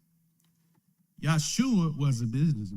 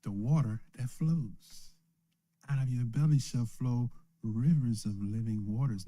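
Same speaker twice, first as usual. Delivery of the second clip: ordinary talk, nothing marked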